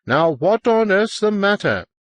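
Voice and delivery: high exasperated call; shouting upstairs. A narrator's reading. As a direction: call up the stairs, pitch jumping high. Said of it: "I shouted up to her".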